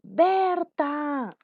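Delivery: high, long call of a name; calling someone. Shown as a demonstration. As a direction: call out to her, as a demonstration. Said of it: "Cuando llaman a alguien". (llamado agudo y alargado)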